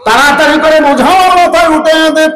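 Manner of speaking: high, insistent acted order; urgent command. Omar urgently orders his servant.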